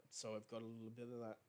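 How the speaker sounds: normal talking voice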